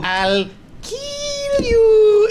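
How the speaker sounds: very high, slow ghostly wail; creepy monster voice. Retold or imitated. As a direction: drag it out like a haunting creature.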